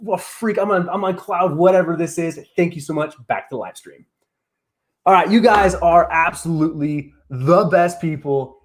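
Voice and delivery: ordinary delivery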